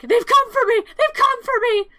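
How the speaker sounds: high, loud, panicked cry; panicked shriek. Acted. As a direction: scream it as if they're coming for you. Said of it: "She starts to panic".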